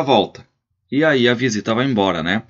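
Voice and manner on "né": normal talking voice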